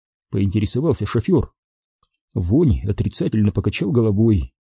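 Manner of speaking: usual speaking voice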